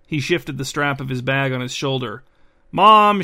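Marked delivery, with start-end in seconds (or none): 2.7-3.2 loud, high shout to summon a parent; raised, high-pitched call to mother; shouting for mom. A narrator's reading. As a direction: holler it through the house as a young girl. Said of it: "shouted the slightly taller of the two girls".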